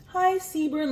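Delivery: mocking how nobody greets a boyfriend by name; mock girlfriend greeting. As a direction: lightly chirp the name like a girlfriend would. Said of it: "call your boyfriend by his name".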